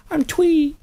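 put-on high tiny child voice; little girl voice. Host mimics the little sister grabbing the mic, high child voice. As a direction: squeak it like a toddler.

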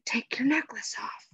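whispered order, all breath; urgent whisper. A narrator's reading. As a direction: whisper it urgently so nobody outside hears. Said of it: "she whispered urgently".

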